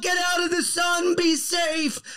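put-on high childish pleading. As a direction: fret like a small boy protecting him. Child voiced worrying at man.

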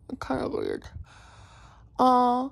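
talking with finger in mouth; mouth pulled open by finger. Real, not acted. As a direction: lips stretched wide open. Seen 1: Finger pulls cheek and lip wide to show back braces.

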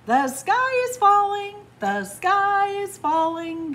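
high, loud answer, slowing into a singsong; shouting back. A narrator's reading. Dancing animals answer Fox, all shouting back. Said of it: "they all shouted back".

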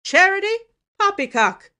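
loud, high, incredulous outburst; scornful snort. A narrator's reading. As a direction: throw it back with loud scorn. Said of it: "snorted Uncle Benjamin".

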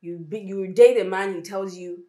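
ordinary delivery

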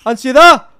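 sudden alarmed yell; alarmed shout. Pretend. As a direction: call out on spotting trouble. Spots Ansiedad, cries out her name.

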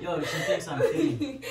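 laughing through the words; high, breaking into giggles; giggling. Real, not acted. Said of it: "He's trying not to laugh".

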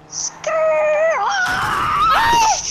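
loud high tire screech into a yell; screeching car noise. Pretend. Kid in the car chase, screeching tires and yelling.

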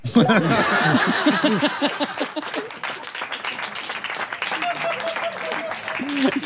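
high, long burst of laughter; big laugh. Real, not acted. (carcajada larga y aguda)

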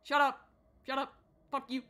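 short burst of rage; angry snap. Real, not acted. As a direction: snap it out hard. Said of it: "I let my rage get the best of me".

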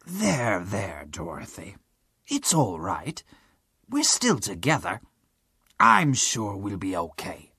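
put-on a kind giant soothing a child; low gentle comfort. Tin Woodman comforts crying Dorothy, low and gentle.

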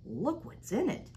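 ordinary delivery

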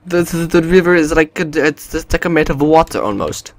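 put-on horrible Russian accent. Player commenting on the river, horrible Russian accent. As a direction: stumble through a hammy Russian accent. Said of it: "that's a horrible Russian accent".

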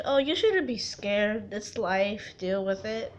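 lightly imitated dismissive remark, low and soft; a hint of a dismissive onlooker; dismissive critics. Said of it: "some people think it's stupid".

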